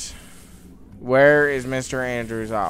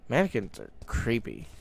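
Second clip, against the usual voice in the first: ordinary talk, nothing marked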